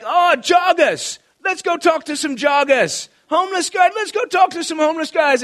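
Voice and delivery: high, loud Australian enthusiasm; excitable Australian. Retold or imitated. Australian friend spotting people to evangelize while driving, excitable high shout. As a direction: cry out in high-pitched Australian delight. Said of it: "And he'd be like".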